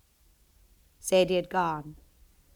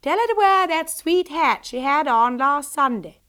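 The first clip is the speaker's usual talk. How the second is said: calling from a distance, a touch louder; voice from upstairs; 6 semitones higher, 7 dB louder than usual. Acted. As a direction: send it down the stairs to her daughter. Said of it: "voice floated down the stairs".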